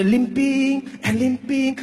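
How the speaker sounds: drawn-out, dragging each word like a limp; slow, heavy, limping rhythm. Shown as a demonstration. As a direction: stretch it like a bad leg. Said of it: "this was how the uncle was limping".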